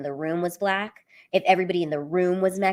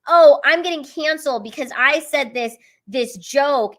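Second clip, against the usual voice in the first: whiny comedian impression; 6 semitones higher, 5 dB louder than usual. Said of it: "comedians are like".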